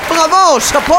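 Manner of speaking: excited bravo. Real, not acted. Cheering the song's end.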